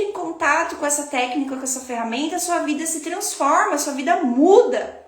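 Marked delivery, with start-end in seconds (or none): none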